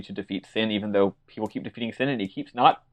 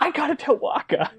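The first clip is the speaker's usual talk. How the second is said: very high, goofy imitation; eager golden-retriever type. Tidus as golden retriever bursting to tell Wakka, high mock eagerness. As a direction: blurt it high like an over-eager puppy. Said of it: "Tedious is literally a golden retriever".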